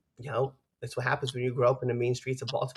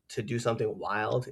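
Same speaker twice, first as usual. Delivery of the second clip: ordinary talk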